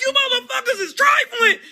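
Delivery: hollering half laughing, pitch up; yelling out the car window at the men. Retold or imitated. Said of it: "I'm out the window like".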